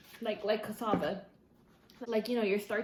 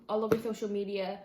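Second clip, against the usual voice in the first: ordinary talk, nothing marked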